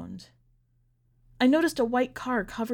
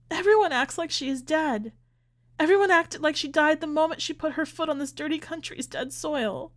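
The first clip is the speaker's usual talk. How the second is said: high-pitched, desperate insistence; anguished plea; 7 semitones higher than usual. A narrator's reading. Grieving mother pleads, upset, with husband.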